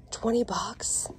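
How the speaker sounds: slow hushed question; whispering. Real, not acted. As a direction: whisper it in surprise. Checking price quietly in store.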